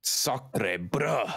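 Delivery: hammed-up French accent, like a tough guy trying French; bad French accent. Cohost jokes about France in a bad French accent. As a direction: toss off a clumsy mock French exclamation. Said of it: "sounded like a Yakuza character trying to do a French accent".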